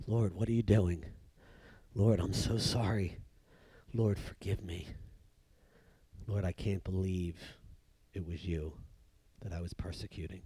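very soft, low, halting murmur; Saul's heartfelt prayer. Acted. Saul repenting to the Lord, hushed low prayer. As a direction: pray it quietly, pausing between phrases, sinking to near nothing. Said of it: "the first time I believe Saul's praying".

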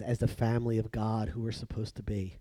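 ordinary delivery

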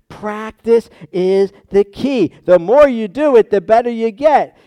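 high, forceful emphasis; emphatic preaching. Real, not acted. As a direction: drive the maxim home from the pulpit. Preacher drives the point home.

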